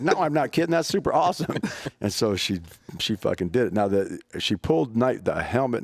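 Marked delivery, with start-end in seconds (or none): 0.0-1.6 higher, laughing through the words; laughing reply. Retold or imitated. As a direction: chuckle while insisting it's great.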